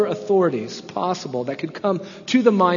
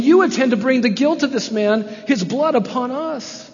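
high, loud, aggrieved complaint; offended council pushing blame back on the apostles; tone of injury; 8 semitones higher, 5 dB louder than usual. Acted. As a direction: play the hurt, defensive accuser. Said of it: "That's a tone of injury".

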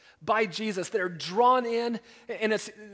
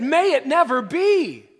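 much higher and much louder, slow cry; horrified denial; 7 semitones higher, 11 dB louder than usual. Retold or imitated. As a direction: cry it out in horror, slowly. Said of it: "strongest negative statement you can make".

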